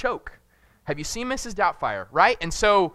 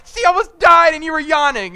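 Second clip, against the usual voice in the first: high, loud, outraged disbelief; incredulous shout; 11 semitones higher, 10 dB louder than usual. Retold or imitated. Father yelling at his calm wife, incredulous shout. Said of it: "You know, like".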